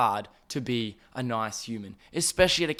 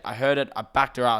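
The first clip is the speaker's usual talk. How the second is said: usual speaking voice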